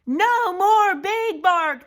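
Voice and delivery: high, loud, slow celebratory call; crowd cheer. Acted. Crowd celebrating Big Bark being gone, cheering. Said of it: "the crowd cheered".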